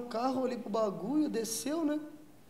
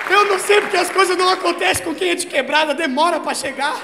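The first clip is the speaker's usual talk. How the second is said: raised, loud comic complaint; pissed-off rant; 8 semitones higher, 10 dB louder than usual. Retold or imitated. Comedian jealous of partner's high, acted loud griping. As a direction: rant in mock outrage at being left out. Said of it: "puto que eu não tava na mesma brisa".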